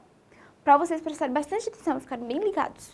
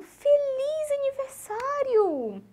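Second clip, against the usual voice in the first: high, drawn-out birthday greeting; birthday cheer; 10 semitones higher, much slower than usual. Acted. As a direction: call it out as everyone does at parties, long and high. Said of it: "todo mundo fala como".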